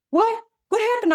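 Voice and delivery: imitating her daughter. Mother voicing her daughter asking what's wrong, high startled child voice. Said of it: "My daughter's over there like".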